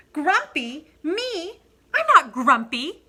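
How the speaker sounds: indignant denial. Acted. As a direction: scoff at the very idea, loud and high. Monkey denies being grumpy.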